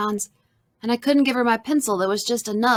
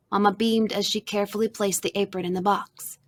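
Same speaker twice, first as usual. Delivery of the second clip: ordinary delivery, nothing marked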